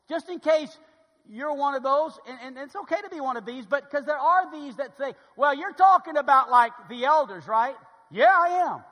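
loud, high-pitched pulpit delivery; full-force preaching. Real, not acted. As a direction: preach it hard to the whole room.

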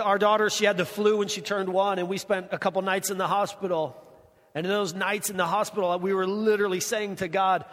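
usual speaking voice

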